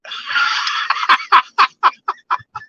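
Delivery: a long, high-pitched wheezing laugh; high squealing laugh. Real, not acted. Recalling the agent laughing, a long high squealing laugh.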